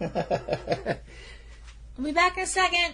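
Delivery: giggling through the words; laughing while talking. Real, not acted.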